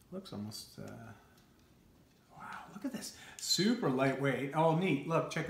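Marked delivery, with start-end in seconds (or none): 0.1-3.2 hushed whisper of wonder. Real, not acted. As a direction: whisper it to yourself. Quiet in front of recording wife.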